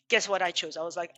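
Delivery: normal talking voice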